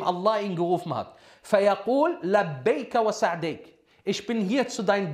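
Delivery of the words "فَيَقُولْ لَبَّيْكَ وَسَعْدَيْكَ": ordinary delivery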